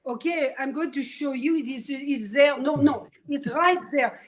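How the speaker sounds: put-on mother's thick French accent, lightly done; a hint of French in every word. Mother reassures her daughter on the beach, in a French accent. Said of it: "She had a thick French accent".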